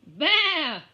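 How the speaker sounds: loud, high bleat; a cheeky bleat; lamb bleat. Acted. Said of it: "went the lamb".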